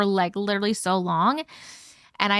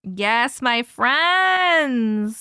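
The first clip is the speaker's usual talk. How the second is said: ordinary talk, nothing marked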